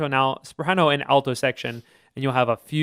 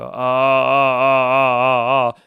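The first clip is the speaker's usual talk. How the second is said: louder grunted uh uh uh; stepwise grunts; 7 dB louder, much slower than usual. Retold or imitated. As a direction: imitate a student chopping the pitch into grunts. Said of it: "instead of going up and down in pitch".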